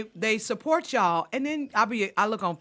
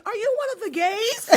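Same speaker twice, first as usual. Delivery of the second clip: high mother voice; 12 semitones higher than usual. Retold or imitated. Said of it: "The mother ... She said".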